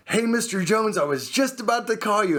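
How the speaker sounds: high, put-on eager greeting; ingratiating. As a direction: greet a dreaded client too eagerly. Acted phone greeting to dreaded client.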